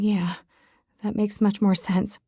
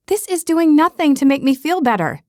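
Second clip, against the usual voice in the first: complaining; 7 semitones higher, 3 dB louder than usual. Acted. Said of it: "I complain".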